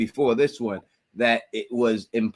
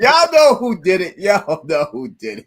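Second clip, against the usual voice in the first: high, loud teasing accusation; loud sing-song dig at the co-host; playful call-out; 6 semitones higher, 8 dB louder than usual. Real, not acted. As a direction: call out the culprit with a grin.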